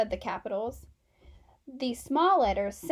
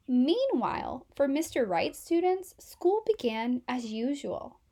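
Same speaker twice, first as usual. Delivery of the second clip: ordinary delivery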